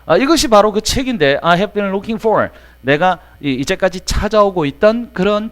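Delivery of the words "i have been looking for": "I have been looking for" read slowly and evenly; slow example reading. Shown as a demonstration. Teacher rereading the example slowly. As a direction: read it out for the class. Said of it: "example repeated".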